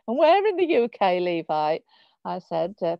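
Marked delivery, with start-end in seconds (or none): 0.1-1.8 put-on Birmingham Wolverhampton accent. Testing Levi's roots, in a Birmingham Wolverhampton accent. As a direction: pitch it up and lay on a Wolverhampton accent. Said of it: "putting on my Birmingham Wolverhampton accent".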